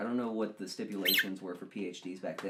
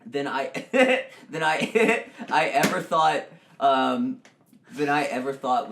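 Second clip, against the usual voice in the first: laughing; 4 semitones higher, 11 dB louder, slower than usual. Real, not acted.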